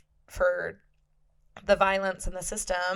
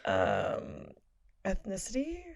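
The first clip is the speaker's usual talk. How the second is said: usual speaking voice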